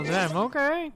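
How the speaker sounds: normal talking voice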